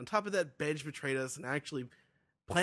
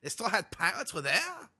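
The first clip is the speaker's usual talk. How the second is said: put-on over-the-top French Canadian accent, pitched up; 4 semitones higher than usual. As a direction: ham up a South Park French Canadian. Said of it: "It's a French accent".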